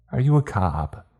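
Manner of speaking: put-on high-pitched little boy's question; small boy. A narrator's reading. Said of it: "asked the smallest boy".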